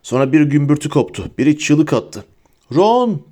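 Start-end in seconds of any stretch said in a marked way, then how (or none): 2.7-3.2 high, urgent call; shouted name. A narrator's reading. Harry calling for his friend, shouting. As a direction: call the name out, pitch jumping up. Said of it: "diye bağırdı Harry".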